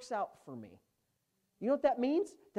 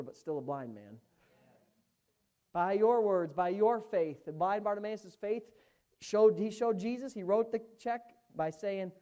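normal talking voice

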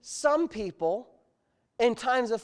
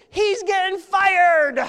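high, slow, loud celebratory shout; joyful shout; 10 semitones higher, 11 dB louder, much slower than usual. Retold or imitated. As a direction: announce it like a celebration. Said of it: "we could hardly talk".

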